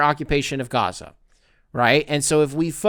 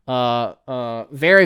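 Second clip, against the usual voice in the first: ordinary delivery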